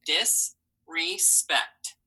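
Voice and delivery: Disrespect said slowly, each syllable pulled apart. Shown as a demonstration. Teacher models Disrespect slowly for the class, syllable by syllable. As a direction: stretch Disrespect out into dis, re, spect. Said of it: "Three syllables".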